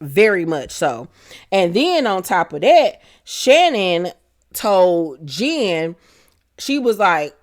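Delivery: ordinary talk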